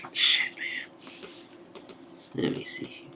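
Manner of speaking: whispered aside in awe. Real, not acted. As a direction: drop to a hushed whisper. Awed reaction to the art.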